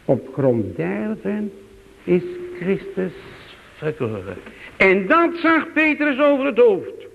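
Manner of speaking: usual speaking voice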